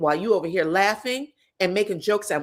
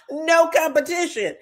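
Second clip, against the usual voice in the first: gloating repeat; 9 semitones higher, 4 dB louder than usual. Retold or imitated. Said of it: "repeated higher".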